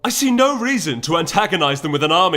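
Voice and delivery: a rival councillor snapping back, loud; angry retort. A narrator's reading. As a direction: shout him down. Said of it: "degenerating into a shouting match".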